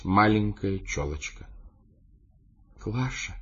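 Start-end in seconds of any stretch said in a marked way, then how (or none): 2.8-3.3 softly calling out a name; quiet call. A narrator's reading. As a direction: call gently, much softer. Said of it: "тихо позвала меня секретарша". (тихий зов)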